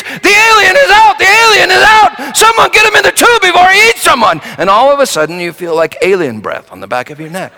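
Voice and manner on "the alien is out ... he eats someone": high frantic hammed-up shouting, faster at the end; mock scream. Technician screams that the alien escaped, hammed-up. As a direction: scream it like fake ride panic. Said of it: "and he screams".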